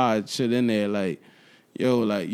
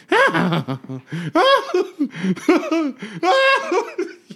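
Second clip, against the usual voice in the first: high, loud maniacal cackle; crazy Joker laugh; 4 dB louder than usual. Retold or imitated. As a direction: cackle like a madman. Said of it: "I'm gonna try to mimic this".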